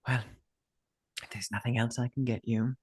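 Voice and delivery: soft, lightly voiced innkeeper; smiling innkeeper. Innkeeper taking leave of customers, smiling and soft. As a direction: say it softly, with a polite smile. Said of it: "She smiles over at Obeha and Forehead and just says".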